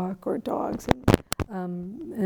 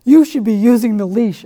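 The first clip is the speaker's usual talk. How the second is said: grumpy neighbour; 11 dB louder than usual. Retold or imitated. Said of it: "He's like".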